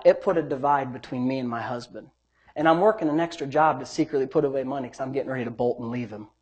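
retold quiet confession. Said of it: "She said".

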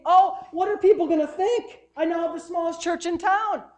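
high, hammed-up whining plea; mock panic of a preacher losing his crowd; begging. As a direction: wail it in exaggerated dismay. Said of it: "he's begging them to stay".

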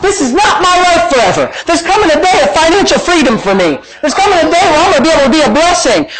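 high, emphatic faith declarations; full-force preaching. Real, not acted.

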